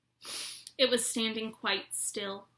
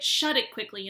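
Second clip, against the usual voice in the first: ordinary delivery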